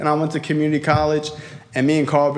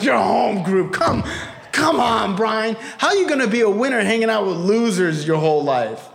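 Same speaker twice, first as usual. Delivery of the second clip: exasperated dad; 4 semitones higher than usual. Retold or imitated. Dad scolding son.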